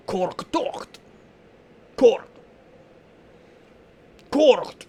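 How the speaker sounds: rough, bold and deep-bassed; rough deep orc voice. A narrator's reading. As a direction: bark it like a brutish orc. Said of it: "You hear something rough and tough, bold and deep-bassed".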